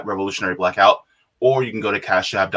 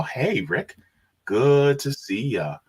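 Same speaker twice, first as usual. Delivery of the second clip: ordinary talk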